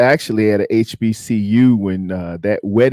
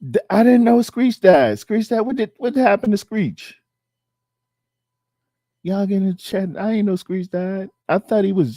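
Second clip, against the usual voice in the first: higher, startled disbelief; real surprise at bad news; shocked disbelief; 8 semitones higher than usual. Real, not acted. Shocked to learn Screech died.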